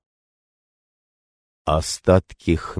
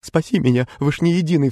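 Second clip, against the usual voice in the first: sobbing plea; 7 semitones higher than usual. A narrator's reading. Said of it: "всхлипнул он".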